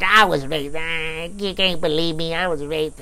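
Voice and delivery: slow, whining put-on voice; mocking mimicry. Officer parroting the detainee back at her, mocking. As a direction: mimic her complaint in a drawn-out whine.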